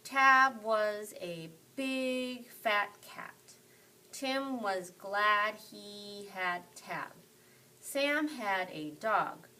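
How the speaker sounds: slow, choppy, one word at a time; slow word-by-word reading. Shown as a demonstration. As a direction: sound each word out slowly like a beginner. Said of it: "not like a robot".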